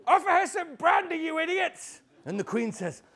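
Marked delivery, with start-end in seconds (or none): 0.1-2.0 put-on very high, loud girl's yell; shrieked, impatient young girl; exasperated shout. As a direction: scream the order at your clueless parents. Girl orders parents to offer brandy.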